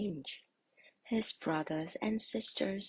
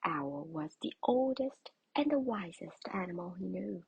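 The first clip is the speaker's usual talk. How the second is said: ordinary delivery, nothing marked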